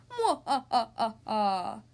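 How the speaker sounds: slow villain cackle; evil laugh. Acted. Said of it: "he sneers".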